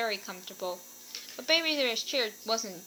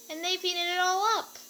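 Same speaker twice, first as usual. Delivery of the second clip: higher, small upset bear cub; little Baby Bear voice; 8 semitones higher, 4 dB louder than usual. A narrator's reading. Baby Bear upset about his empty bowl, small high voice. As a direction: whine like a wronged little cub.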